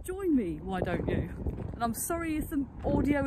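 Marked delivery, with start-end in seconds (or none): none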